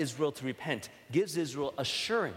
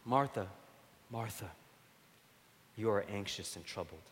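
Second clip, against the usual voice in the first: tender rebuke; 5 semitones lower, 4 dB quieter than usual. Retold or imitated. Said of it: "with great pathos".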